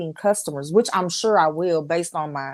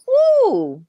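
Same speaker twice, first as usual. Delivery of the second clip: excited cheer; 8 dB louder than usual. Pretend. Mock unboxing excitement.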